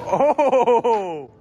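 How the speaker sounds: high, loud excited cheer; excited shout. Real, not acted. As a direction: shout with delight. Excited shout over the clip.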